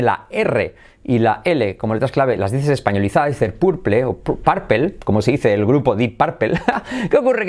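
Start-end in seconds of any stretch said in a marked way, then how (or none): 3.5-4.4 purple said the Spanish way, every letter as written; Spanish-style purple. Shown as a demonstration. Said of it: "diciendo purple". 4.4-5.0 purple said the English way, /paɾpel/, with a dark final L; native purple. Shown as a demonstration. Teacher shows the native purple to Spanish students. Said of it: "el nativo dice purple".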